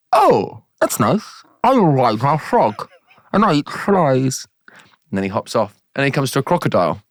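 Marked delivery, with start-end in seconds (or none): 0.1-4.5 high, exaggerated wide-mouthed frog; big cartoon frog with leaping pitch. Frog introduces himself to a giraffe, high exaggerated voice.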